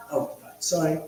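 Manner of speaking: normal talking voice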